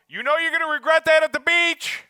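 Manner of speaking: loud, high nagging inner voice; scolding inner voice. Acted. Inner voice scolding a late-night snacker, loud and nagging. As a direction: scold yourself to put the cookie down.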